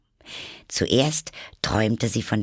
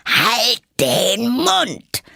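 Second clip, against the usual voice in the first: very high, slow furious yell; 13 semitones higher, 6 dB louder, much slower than usual. A narrator's reading. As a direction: scream it red in the face. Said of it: "indem sie purpurrot wurde".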